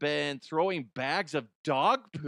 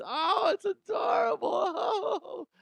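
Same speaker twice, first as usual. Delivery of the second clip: gushing. Acted. Host gushing over cloned cute animals, high squeal. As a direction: coo like seeing a puppy.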